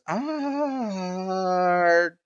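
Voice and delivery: high, loud, stretched-out proclamation; slow, grand high-pitched announcement; flamboyant host. Acted. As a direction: hail the hero, dragging out the name. Host theatrically hails the visitor.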